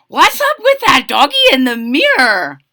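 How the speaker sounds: high, loud, puzzled outburst; surprised kitten. A narrator's reading. Skippy spots a doggy in the mirror, surprised high voice. Kitten startled by his reflection.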